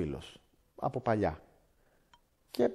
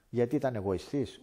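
usual speaking voice

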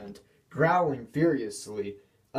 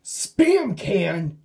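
louder, higher indignant echo; fuming; 7 semitones higher, 5 dB louder than usual. A narrator's reading. Diesel fumes at being called spam can, louder. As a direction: bristle at the insult. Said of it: "fumed one nine nine".

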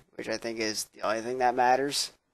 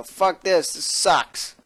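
loud, higher curse at a boring game; fed-up outburst; 6 semitones higher, 7 dB louder, much slower than usual. Pretend. Quitting a dull game in disgust, fed-up loud cursing. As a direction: snap and give up on it. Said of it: "I might go".

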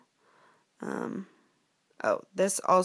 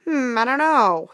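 louder, raised-pitch chipmunk voice; unsure chipmunk friend; 5 semitones higher, 9 dB louder, slower than usual. Acted. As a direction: puzzle it out as a little sidekick. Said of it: "said Jacques".

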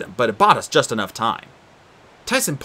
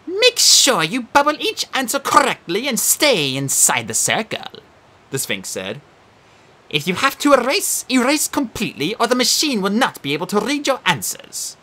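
high prim quizmaster creature; Sphinx voice; 10 semitones higher than usual. Acted. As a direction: play a fussy exam monster.

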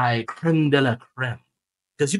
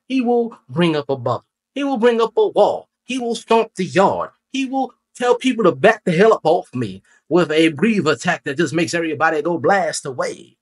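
raised, emphatic preacher cadence; weird preacher man; 4 semitones higher than usual. Pretend. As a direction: build each phrase like a preacher hyping a congregation. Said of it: "I sound like a weird preacher man".

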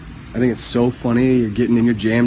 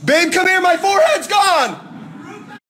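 high, slow, squealing mock cry; mock panic; much slower than usual. Calling to babe, a high hammed-up panicked squeal. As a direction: shriek it like a dramatic girlfriend.

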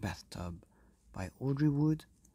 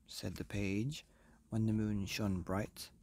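usual speaking voice

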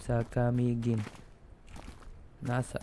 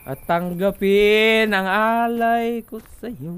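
slow, loud, higher hammed-up pronouncement; mock solemn offering; 7 semitones higher, 9 dB louder, slower than usual. Player presenting bottles to mother, slow mock grandeur. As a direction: proclaim it like a priest giving gifts.

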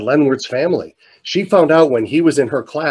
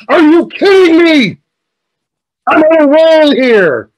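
slow, high, hammed-up yell; exuberant incredulous shout; 8 dB louder, slower than usual. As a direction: shout down the interruption, drawing out the words. Retold exuberant retort to teacher.

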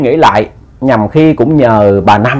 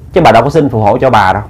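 ordinary delivery, nothing marked

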